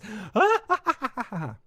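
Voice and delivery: high cackle closing the villain speech; hammy villain cackle. Acted. Villain gloats over killing Corlys, high hammy cackle. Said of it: "eating scenery".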